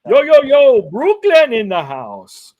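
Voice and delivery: loud, slow hype greeting; hype catchphrase. Retold or imitated. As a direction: shout it like a hype man. Repeats Eddie's catchphrase.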